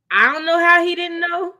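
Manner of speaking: loud, high exasperated disbelief; exasperated outburst. Real, not acted. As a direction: throw the question up in disbelief. Heated rant about costume.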